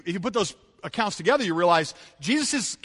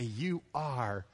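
lower, softer reverent confession; hushed worship; 6 semitones lower, 9 dB quieter than usual. Retold or imitated. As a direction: speak it in quiet awe. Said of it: "fall at his feet and say".

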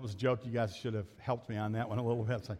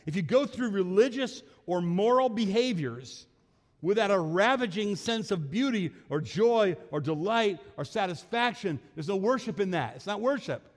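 preached full force, voice raised high; emphatic repeat, louder; 6 semitones higher, 6 dB louder than usual. Shown as a demonstration. Preacher repeats Keller's line, louder and higher. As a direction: drive the quote home to the congregation. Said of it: "Let me repeat that".

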